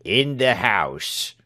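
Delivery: slow, lightly voiced sour reply; a hint of a surly little man; sour servant. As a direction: drag it out sullenly. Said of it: "returned Britain".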